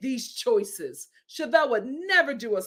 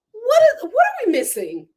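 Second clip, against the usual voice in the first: baffled; 11 semitones higher, 7 dB louder than usual. Real, not acted. Exasperated question.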